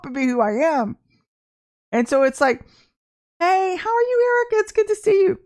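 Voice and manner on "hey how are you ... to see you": bright greeting. Real, not acted. Preacher greets a viewer joining the live stream, delighted.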